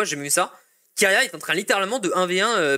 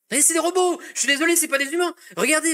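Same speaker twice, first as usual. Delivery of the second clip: exasperated outburst; 10 semitones higher than usual. Real, not acted. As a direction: burst out, half laughing in disbelief. Streamer exclaims about pro players.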